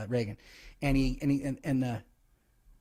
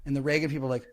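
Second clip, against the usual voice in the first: ordinary delivery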